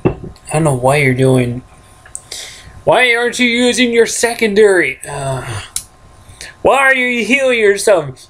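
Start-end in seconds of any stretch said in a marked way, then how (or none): 2.8-5.6 high, pushed-up nagging tone; odd nagging voice. Pretend. Gamer scolding his game character, high mock nagging. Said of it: "Why am I talking like this?". 6.6-8.3 high, nagging "why are you healing yourself"; mock nagging. Gamer nagging his game character, high mock scolding. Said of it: "Why am I talking like this?".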